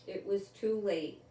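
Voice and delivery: usual speaking voice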